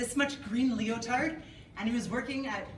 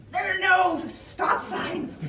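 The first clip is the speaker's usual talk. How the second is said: high, loud, slow exclamation; exasperated shout; 9 semitones higher, 6 dB louder, much slower than usual. Acted. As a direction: shout it over the honking. Exclaiming about chaotic Cairo traffic.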